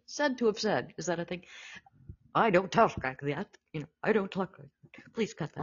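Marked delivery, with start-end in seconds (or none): 2.3-5.6 a lightly voiced Cary Grant protesting; a hint of Grant's odd cadence; Cary Grant impression. Host quoting Cary Grant denying the voice, lightly voiced Grant. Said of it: "was said to have said".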